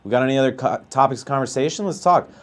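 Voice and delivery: normal talking voice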